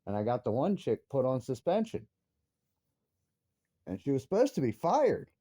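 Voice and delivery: ordinary delivery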